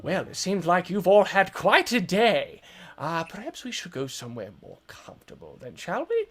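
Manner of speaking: genial suggestion with a high questioning finish; old man wizard. Acted. Wizard addressing the frozen party, lilting old-man voice. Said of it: "he's like".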